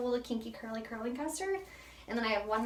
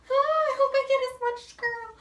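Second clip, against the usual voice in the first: high, loud, fast mock-worried squeal; high fast fretting; 9 dB louder, faster than usual. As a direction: fret in a squeaky rush. Worried about her mistake.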